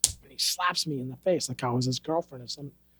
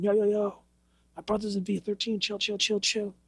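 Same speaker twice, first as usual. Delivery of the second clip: nephew calming; 5 semitones higher than usual. Retold or imitated. Nephew steps in to calm.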